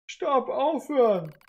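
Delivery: hoch, laut, langsam; verzweifelter Aufschrei. Acted. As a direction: flehend Schluss schreien. Android wehrt Adams Worte ab. (verzweifeltes Schreien)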